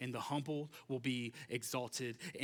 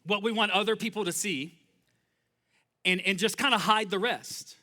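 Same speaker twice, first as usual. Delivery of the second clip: loud, driving sermon voice, pitch raised; impassioned pulpit delivery, louder and higher; full-force preaching; 8 semitones higher, 10 dB louder than usual. Acted. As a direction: preach it hard to the room. Preaching about Pharisees.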